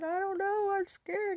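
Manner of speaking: high, tearful, crying out scared; mimicking her past self in tears; retold sobbing. Answering her worried dad while sobbing. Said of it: "I'm sobbing".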